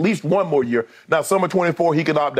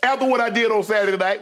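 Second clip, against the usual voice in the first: imitating LeBron; 6 semitones higher than usual. Voicing LeBron, laughing.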